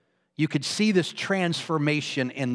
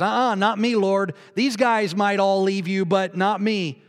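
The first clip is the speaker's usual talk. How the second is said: louder and higher, insisting he'll stay; confident loud vow; bold protest; 5 semitones higher, 5 dB louder than usual. Acted. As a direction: Peter swearing he'd never leave. Peter boldly protests loyalty.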